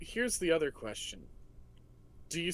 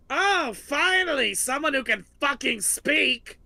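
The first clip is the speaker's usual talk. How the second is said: much louder and higher, exasperated roar; relieved monster yell; 10 semitones higher, 9 dB louder than usual. Acted. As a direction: roar with relief at finally being understood.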